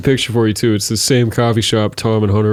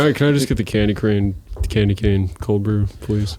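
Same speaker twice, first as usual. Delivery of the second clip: deflated; 3 semitones lower, 4 dB quieter than usual. Retold or imitated. Friend showing how to order coffee, deflated. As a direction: let the order sag toward a resigned please. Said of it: "you gotta be deflated about it".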